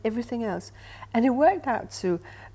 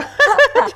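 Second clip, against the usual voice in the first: loud laugh; 16 dB louder than usual. Real, not acted. Reacting to big question.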